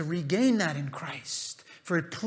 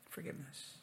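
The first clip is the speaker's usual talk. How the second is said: whispered; 7 semitones lower, 24 dB quieter than usual. Acted. Quiet emphatic word in sermon.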